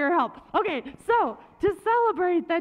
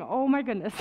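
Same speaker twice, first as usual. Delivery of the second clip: ordinary talk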